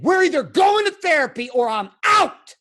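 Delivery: loud, high shouted ultimatum; furious ultimatum. Retold or imitated. Husband gives his wife a therapy ultimatum, angry shout. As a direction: shout it as your last word. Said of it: "we blew up in the kitchen".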